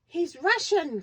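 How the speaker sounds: high, loud cry of discovery; a boy blurting out his big discovery, high and loud; excited cry. A narrator's reading. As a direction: burst out with the realisation, gasping. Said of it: "cried Peter".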